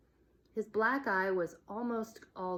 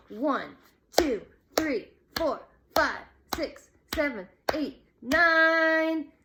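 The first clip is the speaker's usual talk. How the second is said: building count; 8 semitones higher, 10 dB louder, slower than usual. A narrator's reading. Counting out the knockout.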